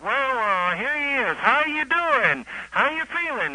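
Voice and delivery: high, bright mock host greeting; hammed-up cheery radio host. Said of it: "they would say, like".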